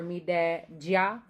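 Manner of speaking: slow Jamaican phrase, rising end; Jamaican patois. Shown as a demonstration. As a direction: stretch it out in light Jamaican patois. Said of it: "elements of Jamaican patois".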